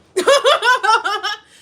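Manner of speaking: bursts into a high, loud cackle; loud laugh. Real, not acted. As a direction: laughs hard at the memory. Laughs at her own story.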